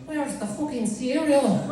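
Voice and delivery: put-on high-pitched Irish kid; Irish accent. Small child rummaging at four A M, high Irish accent. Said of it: "I still had an Irish accent".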